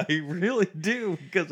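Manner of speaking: laughing out loud; high laughing reply. Real, not acted. Laughing at pirate remark.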